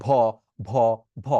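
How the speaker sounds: ভ as a breathy bh with air pushed out; wrong breathy bh. Shown as a demonstration. Teacher showing the wrong airy version students make. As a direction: let air escape on each ভ. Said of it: "মানে ধারের কাছেও নাই".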